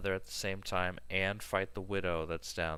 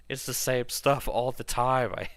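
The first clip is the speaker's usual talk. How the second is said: louder, higher fed-up venting; exasperated rant; 6 semitones higher, 7 dB louder than usual. Real, not acted. Complaining to the stream about his friend's calls, loud and fed up. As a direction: let the frustration boil over.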